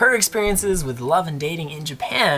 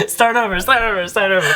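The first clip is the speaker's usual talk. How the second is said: laughing; 10 semitones higher, 11 dB louder than usual. Real, not acted. Laughing, calling for a retake.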